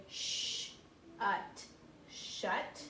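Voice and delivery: "sh" then "ut" then "shut", slow and split; sounding out. Shown as a demonstration. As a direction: blend "sh", "ut" into "shut" slowly. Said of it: "So we can say".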